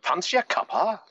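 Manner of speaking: a high, hammed-up posh British offer of tea; teasing the Brits with a posh British voice; mock posh British. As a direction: put on a jokey posh British accent. Mocking the Brits.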